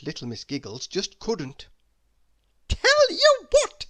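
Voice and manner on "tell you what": very high, loud, slow goofy voice; a clown leaning in; silly Mister Funny. Acted.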